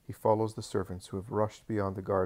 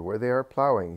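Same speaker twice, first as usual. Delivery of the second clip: insistent landowner; 3 semitones higher, 7 dB louder than usual. A narrator's reading. Doris repeats his lie.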